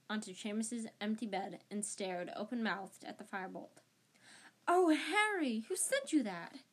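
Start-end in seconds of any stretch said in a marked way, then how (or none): 4.6-6.6 high, drawn-out "oh" of wonder; amazed. A narrator's reading. Hermione gapes at Harry's new Firebolt, amazed. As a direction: marvel at it, starting high and slow. Hermione stares open-mouthed at the broom.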